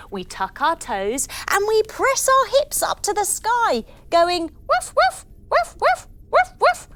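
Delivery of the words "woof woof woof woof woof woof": high playful woofing like a puppy; dog woofs. Shown as a demonstration. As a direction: bark it out high and bright for the kids. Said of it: "Let's try woofing like a dog".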